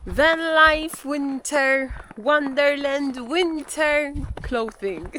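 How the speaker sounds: slow, stretched sing-song words; drawn-out sing-song. Real, not acted. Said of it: "back to winter".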